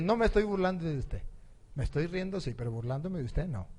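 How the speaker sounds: soft, calm denial; imitated unruffled servant, voice dropped; smiling servant girl. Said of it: "la muchacha estaba muy sonriente".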